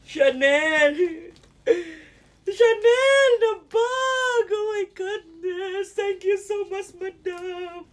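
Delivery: high, loud, drawn-out squeal of delight; overwhelmed thanks for a dream gift; ecstatic disbelief. Real, not acted. Overwhelmed by gifted designer bag. (tuwang-tuwa, halos maiyak)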